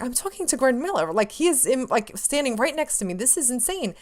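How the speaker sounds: high-pitched, re-enacted giddy amazement; starstruck disbelief. Fan standing right next to Greg Miller, starstruck disbelief. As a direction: marvel at it in a high voice.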